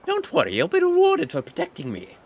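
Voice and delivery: put-on very high, bossy old woman; weird old hag voice. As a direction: hurry them like a crone. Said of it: "His voice is all over the place".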